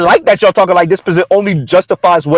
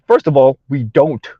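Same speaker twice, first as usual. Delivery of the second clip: ordinary talk, nothing marked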